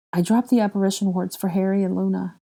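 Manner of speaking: ordinary delivery, nothing marked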